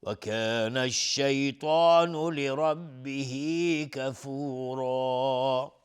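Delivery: slow, drawn-out melodic Quran chant; Quran recitation. Acted. Teacher recites the verse for viewers, slow melodic chant. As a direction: recite each word slowly and melodically, like tilawa.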